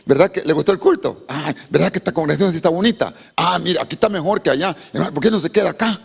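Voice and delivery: butter up the visitor to lure them; mock flattering pastor. Pastor flatters a visitor from another church, fawning.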